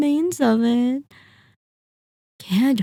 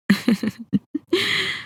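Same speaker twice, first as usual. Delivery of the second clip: light, high chuckle trailing upward; amused vampire chuckle; 10 semitones higher than usual. Acted. Vampire amused by the hunter's unease, amused chuckle.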